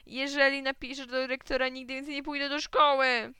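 high, loud wail; upset boy threatening his father; tearful protest. A narrator's reading. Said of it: "chlipnąłem". (płaczliwy, podniesiony protest)

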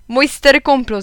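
loud, high hearty toast; called out. A narrator's reading. Guest toasting dad, loud jovial call. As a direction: raise the glass and boom it. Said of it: "zawołał pan Labier".

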